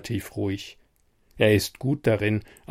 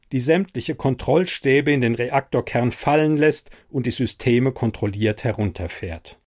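ordinary delivery, nothing marked

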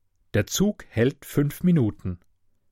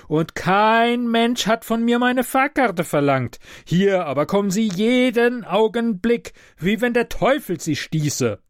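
put-on high, plaintive complaining old man; a sleepless sufferer wailing his grievances; whining invalid; 8 semitones higher than usual. Sleepless sick passenger scolding the conductor, higher whining voice.

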